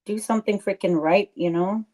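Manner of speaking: normal talking voice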